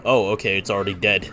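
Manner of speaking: usual speaking voice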